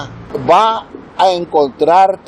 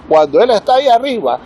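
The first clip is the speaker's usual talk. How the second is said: ordinary talk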